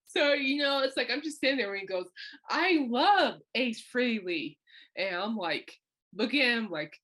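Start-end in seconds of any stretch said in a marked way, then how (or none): 2.5-4.6 very slow, raised fan gush; hammed-up imitation of an adoring doctor. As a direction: stretch it out like a smitten fan. Retells the doctor's gushing again.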